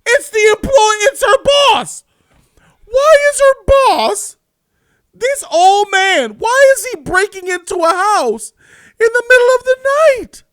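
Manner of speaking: high, loud, disbelieving yell, slowing on the questions; outraged shouting. Real, not acted. Reviewer yelling at the absurd plot twist, outraged. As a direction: shout your disbelief at the screen.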